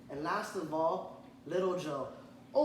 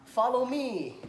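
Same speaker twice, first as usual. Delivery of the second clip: high, loud call to the ducklings; mother duck calling her ducklings to follow; mama duck calling; 7 semitones higher, 6 dB louder, slower than usual. Acted. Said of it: "Mama Duck called to her brood".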